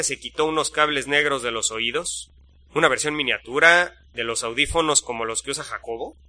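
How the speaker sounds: ordinary delivery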